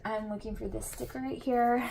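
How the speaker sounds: ordinary talk, nothing marked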